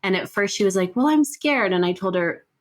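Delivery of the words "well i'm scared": put-on high, small frightened kid voice; scared child voice. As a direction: voice a little girl who's scared. Child quoted at bedtime.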